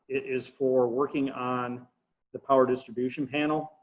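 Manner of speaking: ordinary delivery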